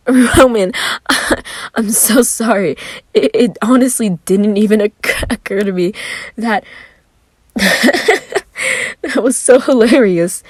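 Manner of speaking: words squeezed out between laughs; laughing. Acted. Virgil apologizing to Roman while laughing hard.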